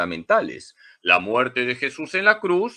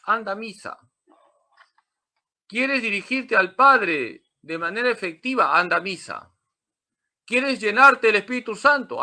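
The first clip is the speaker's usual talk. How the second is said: rousing call; 8 semitones higher, 4 dB louder than usual. Real, not acted. Rhetorical calls to go to Mass.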